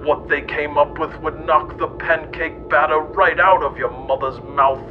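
ordinary talk

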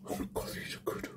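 unvoiced whisper through food. Real, not acted. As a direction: breathe it out while chewing. Eating on camera, mouth full.

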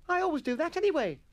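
put-on very high, loud boy voice; protesting schoolboy. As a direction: object in a piping schoolboy voice. Atkinson protests.

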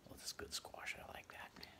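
soft unvoiced aside; hushed aside to the page; whispered aside. Real, not acted. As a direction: whisper to yourself while looking. Reader aside about the picture.

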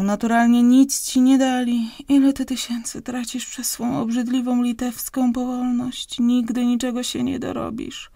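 loud, high reproachful tirade; scolding wife. Acted. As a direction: berate him for throwing away fortunes. Wife scolds husband about money.

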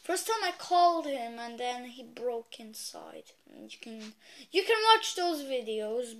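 ordinary talk

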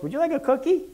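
sing-song it like a giddy weirdo; high eager Pee Wee Herman impression. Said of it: "in his little Pee Wee weirdo voice".

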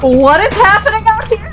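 loud, high surprised call; surprised shout. Real, not acted. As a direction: burst in shocked. Bursting in on friends.

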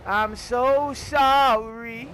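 very slow, stretched-out hammed-up sorry; milk the apology, slowly and theatrically; drawn-out mock apology. Gamer mock-apologises to the car he is selling, slow and hammed-up.

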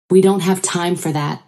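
ordinary delivery, nothing marked

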